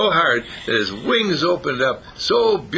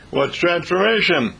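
higher-pitched puzzled rabbit voice; curious rabbit; 8 semitones higher, 3 dB louder than usual. Acted. Rabbit asks the caterpillar about changing, curious high voice. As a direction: play a baffled rabbit, pitched up.

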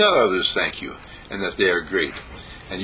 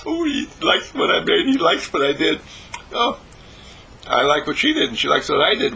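much higher, beaming cook's voice; delighted Sylvia; 5 semitones higher than usual. Retold or imitated. Cook pleased her breakfast is liked, beaming higher voice. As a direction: beam through it like a proud cook. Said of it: "she looks at me with a big smile and says".

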